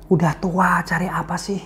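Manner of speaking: hushed, whispered church gossip; sneering gossip whispered to a neighbour; gossipy whisper. Retold or imitated. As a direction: whisper it behind someone's back. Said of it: "Beberapa orang mulai kusak-kusuk".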